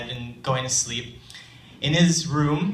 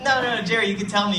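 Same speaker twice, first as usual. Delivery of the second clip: higher, gentle coaxing; 7 semitones higher, faster than usual. Retold or imitated. Storyteller coaxing the shy boy, pitched up and encouraging. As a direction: coax a shy child to speak.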